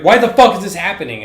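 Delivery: loud, high acted panic and outrage; imitated freak-out. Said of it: "he's, like, freaking out".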